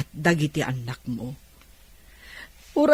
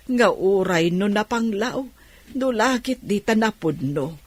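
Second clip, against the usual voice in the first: normal talking voice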